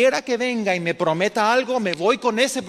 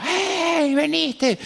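high, joyful call from afar; mother calling out; 10 semitones higher, much slower than usual. Retold or imitated. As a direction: call out happily to your son arriving home. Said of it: "Y me gritaba". (una madre llamando de lejos, agudo y alegre)

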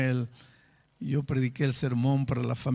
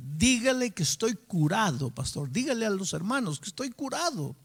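higher-pitched, emphatic insistence; insistent retold plea; 7 semitones higher than usual. Sick doctor urging his pastor to believe him, insistent. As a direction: press the point, pitch lifting on key words.

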